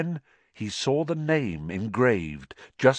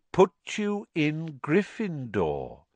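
put-on slow, calm old wizard cutting in; 8 semitones higher, slower than usual. A narrator's reading. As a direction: finish his sentence gently. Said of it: "said Dumbledore calmly".

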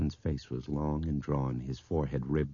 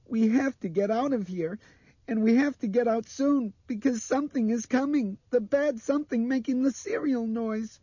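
very high, loud panicked pleading; frightened little girl; 6 dB louder than usual. A narrator's reading. Blind girl begging adults to leave, panicked. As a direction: plead loudly in a child's high voice.

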